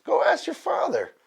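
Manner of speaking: very high mock mom voice; mother bear. Mother bear sending cub to father, high motherly voice. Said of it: "She goes".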